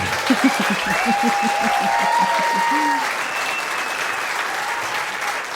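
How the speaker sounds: breathy unvoiced laugh rolling on; long real laugh. Real, not acted. Laughs after rant remark.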